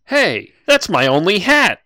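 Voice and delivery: loud, high, slow outrage over the hat; indignant protest. Acted. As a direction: complain like your prize was ruined. Player protests his cut hat.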